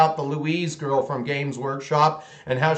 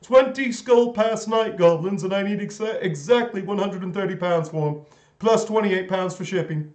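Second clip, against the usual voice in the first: haggling seller lightly hammed up; mock UK eBay seller; 3 semitones higher than usual. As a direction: play a greedy eBay seller quoting his price. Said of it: "flip off the U K eBay sellers".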